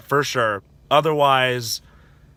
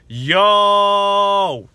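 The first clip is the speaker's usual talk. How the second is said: long, drawn-out high yell; stunned shout; 7 semitones higher, 7 dB louder than usual. Retold or imitated. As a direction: shout it loud and stretch the vowel. Retells his reaction to the line.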